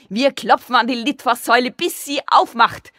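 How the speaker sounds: high, loud, hammering shout; yelling. A narrator's reading. Sigi wants to knock until she opens, excited yell. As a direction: yell it, stretching the ending. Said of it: "schrie Sigi".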